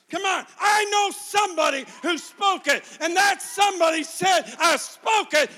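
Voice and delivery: loud, high, hammered preaching shout; full-force preaching. Real, not acted. Preacher rousing the church to come back to life, shouting. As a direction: drive each phrase home to the church.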